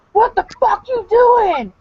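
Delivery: high, loud, slow furious yell; imitated angry screaming. Man catching his wife on his phone, mock furious yell. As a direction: scream it like an enraged boyfriend. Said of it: "he's screaming".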